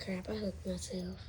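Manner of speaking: ordinary talk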